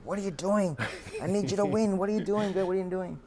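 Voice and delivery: loud, high yelling at his fighter; angry trainer yelling. Retold or imitated. Trainer yelling at his fighter in the corner, angry. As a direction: shake him up with a shout.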